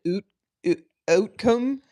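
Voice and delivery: "outcome" said as "oot-come", the vowel drawn out slowly; lightly imitating a Canadian "oot". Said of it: "very Canadian".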